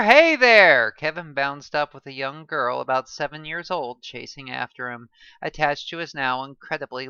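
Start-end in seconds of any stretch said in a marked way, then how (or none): 0.0-0.9 loud repeated call, falling; excited greeting. A narrator's reading.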